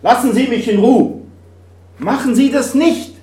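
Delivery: hoch und laut gerufen; verzweifelter Zuruf. Retold or imitated. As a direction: ruf ihm aus der Ferne zu, ihn aufzuhalten. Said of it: "schreit ihn zu". (ein Christ schreit den Mann an der Klippe an, laut und hoch)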